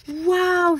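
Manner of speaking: high, amazed wow. Acted. Boy sees the butterfly emerge, high and amazed. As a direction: gasp at something beautiful.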